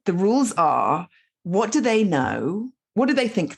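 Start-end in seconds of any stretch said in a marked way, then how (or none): none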